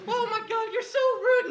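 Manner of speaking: high, loud puppet voice. Acted. Puppet scolds the other puppet, high squeaky voice.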